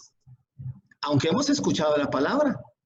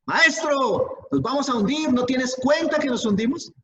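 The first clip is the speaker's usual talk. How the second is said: panicked shout; 8 semitones higher than usual. Retold or imitated. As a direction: cry out as the boat sinks. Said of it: "le gritaron".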